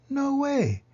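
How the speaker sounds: put-on high, loud no-way of eager kids; kids refusing. Room one oh nine refusing to trade Jerry, high excited cry. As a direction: squeal it like a class guarding its pet.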